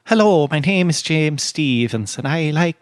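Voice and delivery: demonstrating an Irish accent; playful Irish accent attempt. Shown as a demonstration. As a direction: introduce yourself in a put-on Irish accent. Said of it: "You could probably do a better job".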